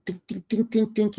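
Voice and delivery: imitated chisel taps, high and light; high chisel dinks. Sculptor chipping at the statue, high little dinks. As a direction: tap out the chisel sound in a high voice. Mimicking a chisel tapping stone.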